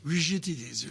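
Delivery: a light mock French accent on rigidities. Host mimicking a French central banker, light French accent. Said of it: "with that French accent".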